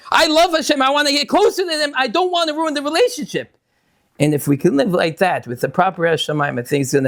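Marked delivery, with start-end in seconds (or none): 0.1-3.6 higher, heartfelt student's voice; earnest devotion. Retold or imitated. Student in yeshiva declaring love for Hashem, earnest and higher. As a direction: say it like a student who truly loves.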